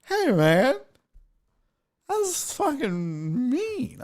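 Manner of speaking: high, slow, trailing-off whine; slow whimpering complaint that fades away soft; hurt heckler. Retold or imitated. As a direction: sound wounded and pouty. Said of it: "And he's like".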